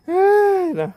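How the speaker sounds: high burst of laughter; laughing. Real, not acted. Laughs after echo.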